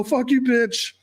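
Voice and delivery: high-pitched mock angry outburst; mock freak-out. Man lashing out at his girlfriend, mock freak-out. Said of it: "I don't freak out".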